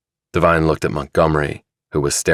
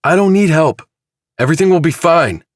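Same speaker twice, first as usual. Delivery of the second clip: much higher and louder, shouting; angry outburst; 13 semitones higher, 5 dB louder than usual. Acted. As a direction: push them away with a yell. Chilton rejects help angrily.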